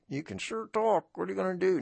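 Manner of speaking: 1 teasing friend voice. Retold or imitated. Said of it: "subject my friends and family".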